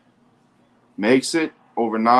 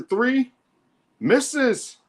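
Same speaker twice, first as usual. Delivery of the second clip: high sharp call of the miss; play-by-play cry on a missed three; excited call; 12 semitones higher than usual. Real, not acted.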